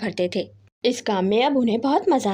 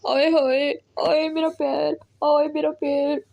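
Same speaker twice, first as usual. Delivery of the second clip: higher, slow hammed-up wailing; fake pain cries; 4 semitones higher, much slower than usual. A narrator's reading. The sparrow fakes an injury to distract her neighbour, hammed-up wailing. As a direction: moan like a faked hurt foot. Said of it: "चिल्लाने लगती है".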